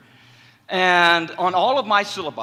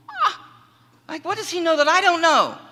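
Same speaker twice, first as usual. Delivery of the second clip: high, louder startled question; alarmed surprise; 13 semitones higher, 5 dB louder than usual. Retold or imitated. Patient startled when the therapist offers to drive him, high and louder. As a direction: jolt up, suddenly worried.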